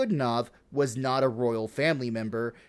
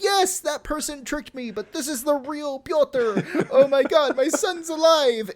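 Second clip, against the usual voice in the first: put-on old mother voice; 12 semitones higher than usual. Old mother vouching for the pretender, high mock old-lady voice. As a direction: gush like a half-blind old mother. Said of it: "the mom's like".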